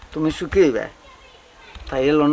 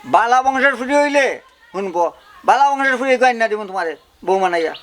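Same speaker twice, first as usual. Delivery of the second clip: emphatic promise; 6 semitones higher, 4 dB louder than usual. Acted. Man promising his friend a bride from a good family, emphatic. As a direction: promise it grandly, pushing the pitch up.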